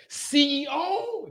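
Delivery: high, slow, drawn-out amazement; high slow astonished question; amazed recognition. Retold or imitated. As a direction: stretch it out in disbelief. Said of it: "he's like".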